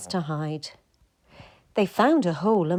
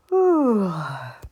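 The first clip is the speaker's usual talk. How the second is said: contented sigh; 5 dB louder than usual. Acted. Settling down to sleep.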